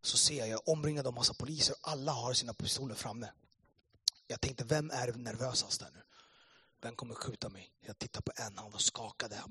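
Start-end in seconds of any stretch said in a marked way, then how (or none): none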